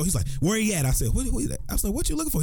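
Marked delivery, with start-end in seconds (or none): 0.4-0.8 urgent question. Retold or imitated. Security kid runs in. 1.1-2.4 puzzled asking. Retold or imitated. He replies to security kid.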